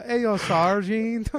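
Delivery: drawn-out joking GI voice; American soldier impression. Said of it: "I'm doing an American soldier right now".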